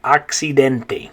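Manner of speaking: accidente as ahk-see-DEN-teh; Spanish vowels in accidente, open a and e; Spanish pronunciation. Shown as a demonstration. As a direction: model accidente slowly for learners. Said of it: "in Spanish it's the word".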